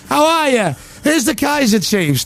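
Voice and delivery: high, drawn-out band introduction; stage shout. Retold or imitated. Peter Kay introducing the band to the crowd, announcing. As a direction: imitate a comic hyping a festival crowd. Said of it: "And he goes".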